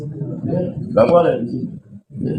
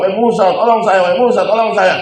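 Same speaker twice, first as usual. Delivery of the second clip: much higher, begging for rescue; desperate plea; 8 semitones higher than usual. Acted. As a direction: beg for your life as you sink. Korun screaming for help. (memohon putus asa minta tolong)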